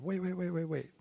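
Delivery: high-pitched mimicked interruption; imitating taken-aback wife. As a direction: stop him short, as the wife in a retelling. Retelling wife stopping him short.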